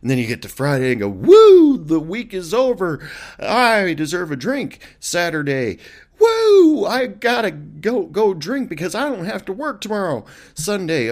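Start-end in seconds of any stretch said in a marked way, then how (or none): 1.1-4.8 loud, high Friday whoop; excited cheer. Retold or imitated. 6.2-10.2 loud, high party whoop; excited whoop. Retold or imitated. Saturday party cheer.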